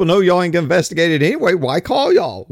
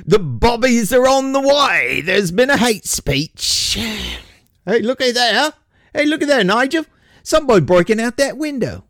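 higher, hammed-up British cop accent; bad British accent; 8 semitones higher than usual. Cop calling to partner Nigel, a hammed-up British accent. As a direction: play a bumbling English bobby, badly on purpose. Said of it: "I apologize for the shitty accent".

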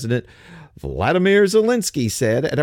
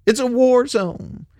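high, slow mock-tragic wail on war zone; sarcastic mock despair; 10 semitones higher, slower than usual. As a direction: draw out war zone like a melodrama. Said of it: "you notice they always say".

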